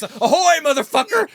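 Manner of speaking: high, loud mock hail; imitated calling out. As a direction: holler out to an empty ship. Imitating uncle calling out aboard ship.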